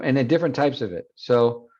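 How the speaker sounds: ordinary talk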